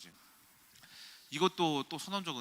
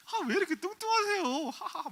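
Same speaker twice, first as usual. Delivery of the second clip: very high, loud imitation ending in "hahaha"; laughing mockery; 5 dB louder than usual. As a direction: mimic a date laughing at you. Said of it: "막 웃더라고요". (높은 목소리로 비웃으며 "하하하" 흉내)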